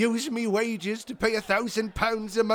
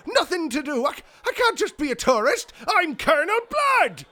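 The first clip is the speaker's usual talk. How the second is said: higher, louder indignant protest; outraged disbelief; 7 semitones higher, 5 dB louder than usual. Acted. Blood protesting to the duke who fired him. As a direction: plead in shocked outrage.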